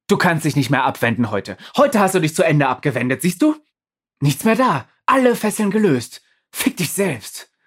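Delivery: high, angry dressing-down; telling off. Acted. Undine chewing Pablo out, high and angry. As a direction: chew him out. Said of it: "und kackt ihn an".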